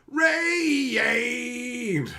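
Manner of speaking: long, high shout of a name; yelling at the screen. Shown as a demonstration. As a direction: holler the name, stretched out.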